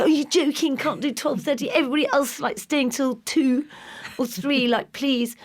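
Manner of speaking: put-on high pleading teen voice; whiny teenager. Teen pleading with mum to stay out late. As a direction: beg like an outraged teenager. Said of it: "They go".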